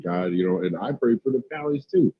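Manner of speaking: ordinary talk, nothing marked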